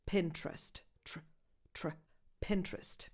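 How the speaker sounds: "Pinterest" softly as "PIN-trest", no E after T; dropped second vowel. Shown as a demonstration. Teacher showing the trick, "Pinterest" with "tr" run together. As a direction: join T and R in "Pinterest". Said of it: "remove the second vowel".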